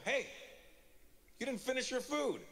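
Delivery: mock parental telling-off; scolding dad. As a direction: imitate a dad calling out a complaint. Dad calls out scolding to daughter.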